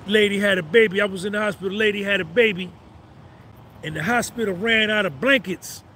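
ordinary talk, nothing marked

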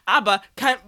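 normal talking voice

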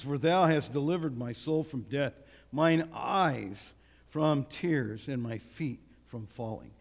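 ordinary delivery

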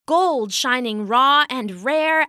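plain reading voice

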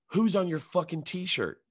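high, drawn-out accusation; accusing demand. Retold or imitated. Woman challenging a man about his t-shirt, accusing.

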